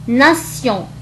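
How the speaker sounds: "ناسيون" with s, then a nasal on. Shown as a demonstration. Teacher showing T before io, s in "ناسيون". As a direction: say "ناسيون" as na-syon, no t sound. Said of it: "فنطقت كحرف السين".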